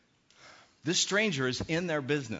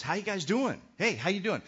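friendly greeting; 6 semitones higher than usual. Retold or imitated. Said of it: "he could have asked something else".

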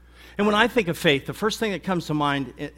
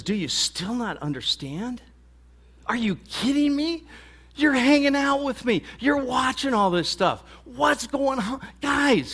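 exasperated, much higher, near shouting; frustrated; 10 semitones higher than usual. Acted. Jesus scolding his slow disciples, exasperated and pitched high. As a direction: plead with them, fed up that they still don't get it. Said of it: "in a sort of a frustrating way".

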